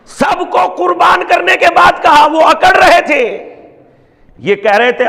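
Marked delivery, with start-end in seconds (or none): 0.0-3.5 preaching at full force. Acted. Preacher to mourners, fervent sermon. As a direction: hammer each phrase to the crowd.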